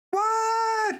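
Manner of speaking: very high, squeaked disbelief; high-pitched incredulous cry; shocked. Acted. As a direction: yelp it in hammed-up shock. Figure reacts to murder accusation.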